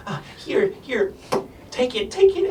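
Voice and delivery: high, urgent, breathy little voice; shoving a potion at someone; pushy gnome girl. Acted. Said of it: "just starts, like, forcing it".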